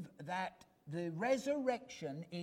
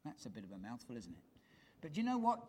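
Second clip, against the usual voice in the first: ordinary talk, nothing marked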